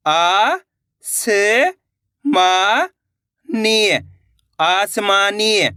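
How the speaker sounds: each syllable drawn out, then the whole word slowly; slow syllable blending. Shown as a demonstration. Teacher spelling out words for children, slow and deliberate. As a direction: sound it out like a phonics teacher.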